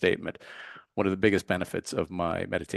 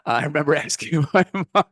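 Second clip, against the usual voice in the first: laughing; 6 semitones higher, 5 dB louder than usual. Real, not acted. Laughing mid-line, higher and louder.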